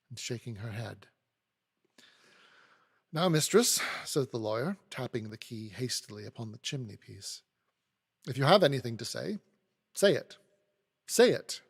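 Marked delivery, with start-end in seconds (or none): 3.1-3.8 louder, higher demand for attention; impatient. A narrator's reading. Lawyer calls the maid to account, impatient. 8.3-11.6 impatient demand. A narrator's reading. Lawyer orders the maid to speak, impatient.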